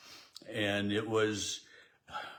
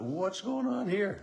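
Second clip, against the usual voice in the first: bewildered thought; 10 semitones higher than usual. Retold or imitated. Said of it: "I thought".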